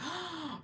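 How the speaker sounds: high, soft gasp; sharp intake of breath in shock. Real, not acted. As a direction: gasp at scary news. Shocked by viewer's scary story.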